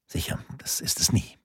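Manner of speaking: tonlos geflüstert, kaum hörbar; geflüstertes Beiseite. A narrator's reading. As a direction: halb zu sich selbst flüstern. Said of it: "schien diesen letzten Satz nicht zu hören". (geflüsterte bittere Bemerkung für sich)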